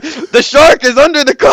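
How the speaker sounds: loud, high-pitched cry of alarm; mock alarmed shout. Mimicking panicked characters spotting a shark, mock alarmed shout. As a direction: yell it in exaggerated panic.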